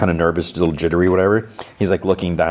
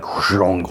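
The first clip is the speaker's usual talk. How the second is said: mouthed heavy whoosh-clang; vocal sound effect of something crashing open; falling panel noise. Retold or imitated. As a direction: imitate a big side panel dropping open. Imitates a wall falling open.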